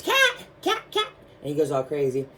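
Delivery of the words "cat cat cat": very high frantic squeak; squeaky mouse panic. Retold or imitated. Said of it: "when he hears".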